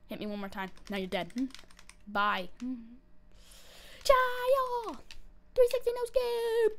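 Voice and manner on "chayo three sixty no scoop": high, loud, drawn-out gamer war cry; hype battle cry. Acted. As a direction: yell it like a trick-shot hype call.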